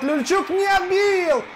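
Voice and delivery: high, slow cry of alarm; excited shout. Real, not acted. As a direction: cry out as the save fails. Goalie misses, commentator cries out.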